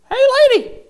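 very high, loud parrot squawk; piercingly high talking-parrot call. Acted. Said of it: "and the parrot".

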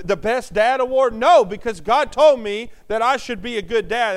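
loud, rising preacher's cadence; full-force sermon delivery; preaching. Acted. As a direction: preach it out to the congregation.

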